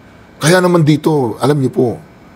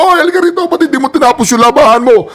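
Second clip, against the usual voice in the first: mock yelling father; 10 semitones higher, faster than usual. Father calling the mother back.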